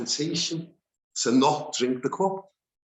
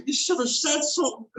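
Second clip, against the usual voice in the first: 1 mock incredulous; 10 semitones higher than usual. Mocking Bilam's excuse.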